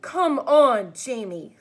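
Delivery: mother angrily rebuking her son. A narrator's reading. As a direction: mom cutting off her son. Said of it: "said Mom angrily".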